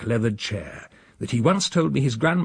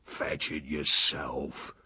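low, slow, quiet grumble; low muttering; 7 semitones lower, 9 dB quieter, much slower than usual. A narrator's reading. Uncle echoes the housekeeper's refusal, muttering low. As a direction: mutter it darkly under the breath. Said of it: "a low muttering and rumbling".

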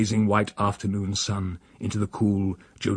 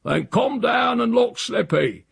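high, drawn-out order; bossy shout; 13 semitones higher, slower than usual. A narrator's reading. Uncle orders the housekeeper downstairs, hollering. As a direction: holler it impatiently up to someone.

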